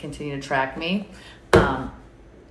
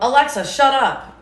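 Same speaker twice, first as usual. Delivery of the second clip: higher, louder bark at the speaker; shouted command; 9 semitones higher, 7 dB louder than usual. Shown as a demonstration. Mother showing how to silence Alexa, loud barked command. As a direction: snap it at Alexa like you mean it. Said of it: "you can tell Alexa".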